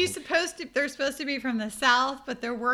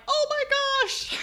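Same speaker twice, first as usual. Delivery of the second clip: mock shock; 5 dB louder than usual. Retold reaction to three-hour line.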